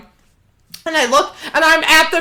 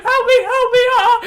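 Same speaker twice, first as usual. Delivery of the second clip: high, loud laughing cry for help; mock scream; 13 semitones higher, 5 dB louder than usual. Retelling being dragged inside, laughing mock scream. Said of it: "I'm screaming".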